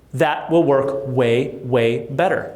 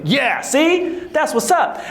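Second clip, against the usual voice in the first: high, smug boasting, pitched up; mock cocky; 9 semitones higher, slower than usual. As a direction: play the overconfident rookie. Smug young self.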